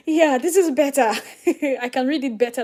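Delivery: relieved laughing approval; laughing as the chart finally looks right. Real, not acted. Still laughing at chart change.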